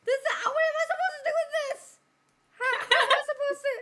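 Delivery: high, laughing half-shout; laughing protest. Real, not acted. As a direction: laugh through the protest, fast. Overwhelmed by too much alcohol.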